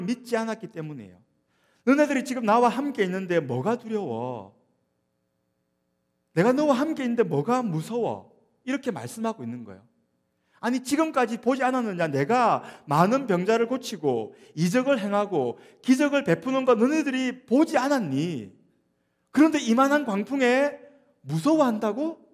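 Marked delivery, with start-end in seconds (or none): 1.8-8.3 raised-voice, higher-pitched scolding; reproachful. Acted. Jesus to disciples in the boat with him, loud reproach. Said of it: "그렇게 지금 책망하고 있는 것입니다". 10.6-22.3 high, loud, incredulous scolding; reproachful. Acted. Jesus reminds disciples of his miracles, loud high reproach. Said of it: "그렇게 지금 책망하고 있는 것입니다".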